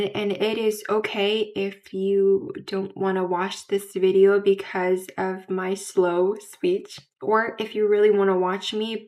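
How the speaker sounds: ordinary talk, nothing marked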